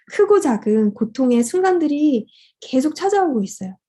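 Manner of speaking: normal talking voice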